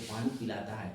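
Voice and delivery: ordinary talk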